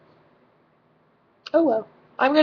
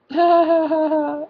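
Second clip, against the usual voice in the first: high nervous giggle; a loud uneasy laugh; awkward laugh; 9 semitones higher, 6 dB louder than usual. Real, not acted. Asked on a date, laughing awkwardly high. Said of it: "I was like".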